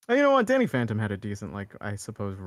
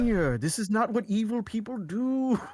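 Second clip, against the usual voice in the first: high, hammed-up dad scolding his son; mock villain father; 9 semitones higher than usual. Rich villain talking to his vainglorious son, mock fatherly scolding. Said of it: "like, talking to his son".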